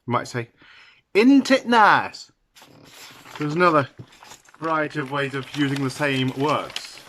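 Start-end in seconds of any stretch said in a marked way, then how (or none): 1.1-2.3 slow, high Yorkshire "in't it"; broad northern Yorkshire demonstration; Yorkshire accent. Shown as a demonstration. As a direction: stretch out "nice" with a flat Yorkshire vowel. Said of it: "for example, Yorkshire".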